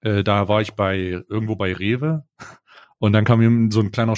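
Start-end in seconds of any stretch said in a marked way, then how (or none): none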